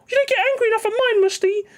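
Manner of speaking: very high, fast squeaky mimicry; mock him in a squeaky little voice; mocking whiny imitation. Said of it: "That's how you sound".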